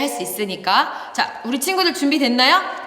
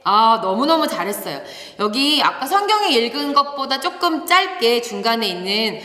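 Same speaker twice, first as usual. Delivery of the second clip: usual speaking voice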